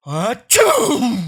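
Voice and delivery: loud fake sneeze; hammed-up sneeze. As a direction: blast a sneeze. Second sneeze.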